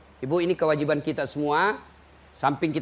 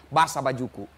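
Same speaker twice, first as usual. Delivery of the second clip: ordinary talk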